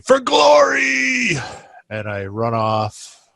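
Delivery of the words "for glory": high, drawn-out heroic yell; long, soaring war cry; screamed battle cry. Acted. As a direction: scream it out as you charge into battle. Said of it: "and I scream".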